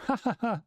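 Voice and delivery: high hearty mock laugh; high hammed-up ha ha ha; Luffy laugh. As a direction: laugh it out cocky.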